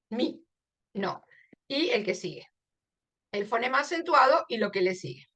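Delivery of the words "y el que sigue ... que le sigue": usual speaking voice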